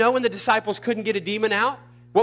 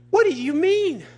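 very high, incredulous squawk; re-enacted outraged question; indignant protest; 12 semitones higher, 3 dB louder than usual. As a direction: throw it back high and exasperated. Acted indignant retort to God.

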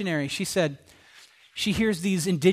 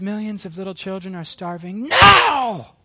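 whisper screaming; 7 dB louder than usual. Retold or imitated. Preacher imitating a missionary at the microphone, whisper screaming. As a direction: build to a held-back scream on "now". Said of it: "that was me whisper screaming".

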